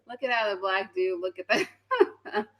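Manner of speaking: breaking into laughter while pointing it out; can't stop laughing at what she sees; laughing through words. Real, not acted. Laughing at clip of man.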